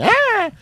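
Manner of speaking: put-on very high-pitched baby squawk; imitated baby cry. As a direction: mimic a wailing infant. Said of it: "that's what babies make".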